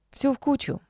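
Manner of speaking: plain reading voice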